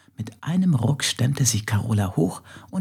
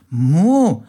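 loud, higher panicked cow's moo; moo cry; 6 semitones higher, 7 dB louder than usual. Acted. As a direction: cry out like a stuck cow. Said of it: "schrie Carola". (lautes, hohes Muhen in Panik)

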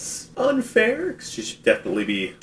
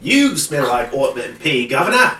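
high, loud hammed-up Cockney; mock Cockney accent; 5 semitones higher, 7 dB louder than usual. Co-host riffing on a cartoon insult, mock Cockney accent. Accent game, ends on Governor.

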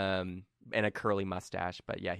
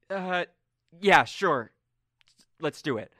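Colm agreeing; 8 semitones higher, 6 dB louder, slower than usual. Acted.

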